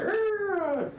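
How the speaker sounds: high, thin goblin snarl; ordinary goblin growl. Shown as a demonstration. Game master demonstrates the other goblins, high little growl. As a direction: imitate a small goblin's "rrrr" up high. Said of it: "It's not like these other".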